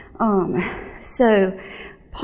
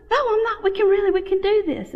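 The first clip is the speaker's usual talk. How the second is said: eager insistence; 12 semitones higher, faster than usual. Retold or imitated. Insisting to husband.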